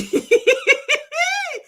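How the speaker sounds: very high cackling laugh; helpless peals of real laughter; laughing to tears. Real, not acted. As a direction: laugh until you cry. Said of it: "I am crying".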